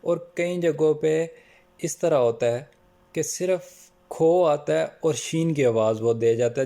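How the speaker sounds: usual speaking voice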